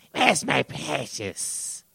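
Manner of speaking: soft, very slow creature voice; Gollum impression. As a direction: draw it out softly like Gollum. Gollum line in mock trailer.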